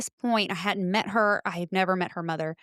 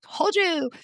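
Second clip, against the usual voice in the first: gloating; 10 semitones higher, 3 dB louder than usual. Retold or imitated. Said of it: "gloat retold".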